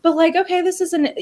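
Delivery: high, mock-innocent naive voice; naive newcomer. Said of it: "you may say, like".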